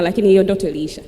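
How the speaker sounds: ordinary talk, nothing marked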